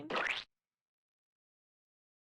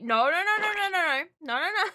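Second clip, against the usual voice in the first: loud no; 6 semitones higher, 7 dB louder, faster than usual. Real, not acted. Streamer reacts to chat.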